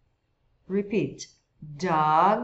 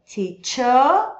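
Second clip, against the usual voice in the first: "Teacher" loud with the R dropped, vowel held; British "Teacher"; 4 semitones higher, 8 dB louder than usual. Shown as a demonstration. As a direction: drop the R and stretch the end of "Teacher". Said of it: "no britânico não enrola esse R".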